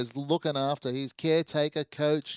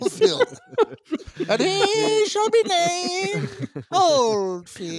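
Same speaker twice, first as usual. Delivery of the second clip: booming biblical proclamation; 6 dB louder, much slower than usual. Pretend. Mock biblical naming.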